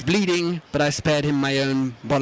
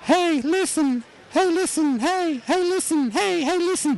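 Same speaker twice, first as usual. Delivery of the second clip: much higher, squeaky "hey, listen"; nagging fairy sidekick; 13 semitones higher than usual. Retold or imitated. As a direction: pester like a tiny game fairy. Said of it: "So your favourite isn't".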